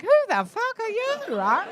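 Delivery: ordinary delivery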